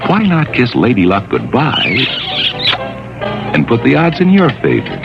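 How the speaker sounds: usual speaking voice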